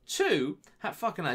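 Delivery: normal talking voice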